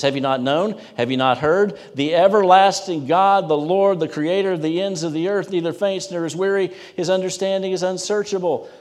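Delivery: high, loud, ringing proclamation; proclaiming scripture. Acted. Preacher proclaiming God's strength to congregation, high and loud. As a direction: declare it like a herald. Reads scripture at full force.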